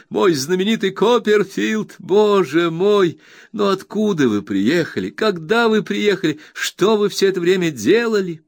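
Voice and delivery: high, bright-eyed friend overjoyed; eager Traddles. Acted. Traddles reassures his old friend Copperfield, eager high voice. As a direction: gush with happiness at the reunion.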